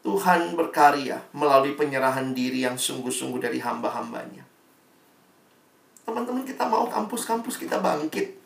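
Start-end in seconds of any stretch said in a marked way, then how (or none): 6.1-8.4 high, loud rallying appeal; fervent call. Real, not acted. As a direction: rouse the students. Rallying the listeners. (seruan berapi-api kepada mahasiswa)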